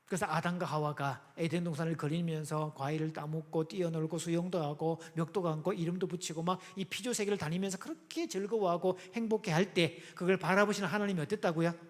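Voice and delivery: ordinary talk, nothing marked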